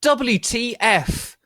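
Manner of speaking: loud, very high, slow W T F; slow outraged yell, letter by letter; angry shout. Acted. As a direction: spell it out furiously. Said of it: "I shouted angrily".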